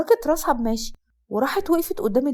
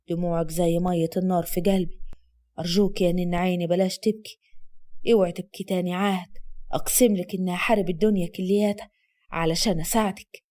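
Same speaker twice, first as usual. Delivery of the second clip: soothing; 6 semitones lower than usual. Acted. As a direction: hold her and calm her. Asad tender low.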